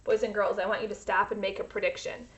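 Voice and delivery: ordinary delivery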